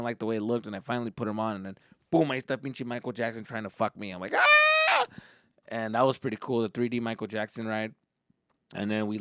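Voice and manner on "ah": high-pitched mock shriek; short loud squeal of fright; startled scream. Boy startled by Thriller monster, high scream.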